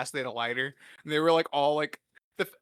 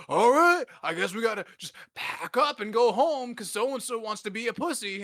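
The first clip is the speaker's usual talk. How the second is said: high, loud opening easing down; hammed-up grudging announcement; mock exasperation; 6 semitones higher, 4 dB louder than usual. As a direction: imitate a fed-up uncle giving in. Retold uncle grudgingly giving up.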